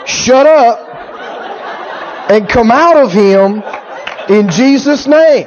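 slow, segmented mock command; deliberate, broken-up practice of an exorcism line; rehearsing the formula. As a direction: piece the line together with pauses. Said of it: "Let's go over it again".

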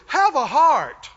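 slow pleading. Retold or imitated. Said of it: "Is he in begging mode?".

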